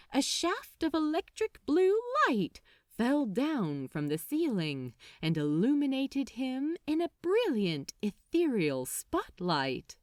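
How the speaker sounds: ordinary delivery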